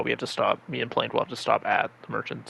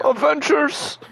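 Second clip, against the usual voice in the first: pompous rallying cry; 7 dB louder, much slower than usual. Pretend. Joking villain call.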